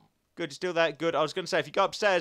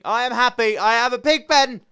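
excited boast; 8 semitones higher, 12 dB louder than usual. Acted. Boasting about the new pig pen.

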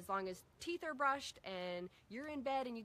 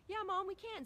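put-on higher, brighter child's reply; kids answering; 10 semitones higher, 3 dB louder than usual. Kids cheerfully agreeing to their mom, light child voice. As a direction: chirp it like an eager kid. Voicing her kids' reply.

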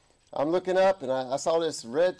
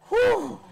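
high, whooping "whew"; exclaimed whew; 4 dB louder than usual. Real, not acted. Preacher marvels at grandmother's conversion story, high exclaimed whew. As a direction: let out a loud, high-pitched whew.

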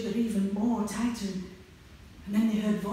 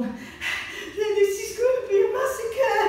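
panting; 7 dB louder, slower than usual. Acted. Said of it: "Georgie panted".

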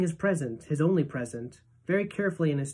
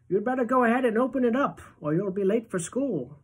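put-on higher, faster old-man urging; eager grandpa; 7 semitones higher, 3 dB louder than usual. A narrator's reading. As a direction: hurry him along like an excited grandfather.

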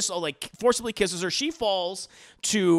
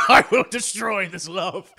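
loud hammed-up villain vow; over-the-top evil proclamation; mock villain; 8 dB louder than usual. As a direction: declare it like a cartoon villain, laughter close by. Mock villain line, repeated louder.

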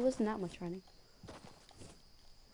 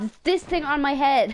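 exasperated shout; 7 semitones higher, 11 dB louder than usual. Real, not acted. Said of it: "I don't see that".

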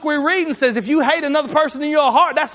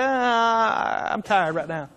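drawn-out sigh, dropping low and soft; mock weary reluctance; 3 semitones lower, 6 dB quieter, much slower than usual. Preacher voicing someone dodging God's call, mock weary reluctance. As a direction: lightly act too tired to bother. Acting a reluctant believer's excuse.

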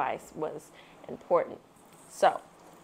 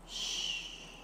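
unvoiced hush; quiet them down with a shh; shushing; 14 dB quieter than usual. A narrator's reading. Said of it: "Papa's bear said".

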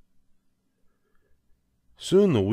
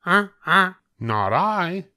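high goose voice, louder refusal; a goose flatly refusing; 11 semitones higher, 5 dB louder, slower than usual. A narrator's reading. Goose refusing to help the hen in a high voice. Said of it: "said the goose".

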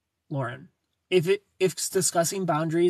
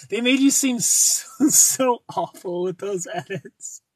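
high, slow words broken by laughter; laughing; 3 semitones higher, 5 dB louder, slower than usual. Real, not acted. Teasing Amanda about her edits, laughing through the words. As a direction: giggle through it as you tease.

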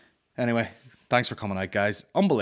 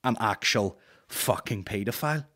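ordinary talk

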